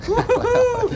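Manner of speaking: high laughing woo-hoo; laughing whoop. Acted.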